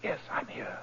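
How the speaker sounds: soft whispered reassurance. Acted. Holmes whispering back to the banker in the dark. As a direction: barely voice it.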